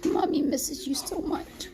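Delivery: tender, high cooing to a lost pet; sweet nothings. Real, not acted. Talking to dead cat's urn.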